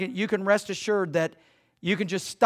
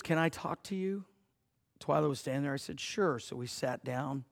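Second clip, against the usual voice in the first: soft, intimate storytelling voice; hushed tender retelling; 3 semitones lower, 8 dB quieter than usual. Retold or imitated. Pastor softly recounting a crying girl at camp, hushed. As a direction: draw the congregation in close and gentle.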